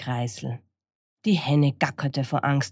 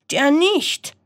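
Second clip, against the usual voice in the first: high, loud indignant crow; crowing rooster; 12 semitones higher, 6 dB louder than usual. Acted. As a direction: crow a refusal. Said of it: "krähte der Hahn".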